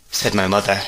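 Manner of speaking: ordinary delivery, nothing marked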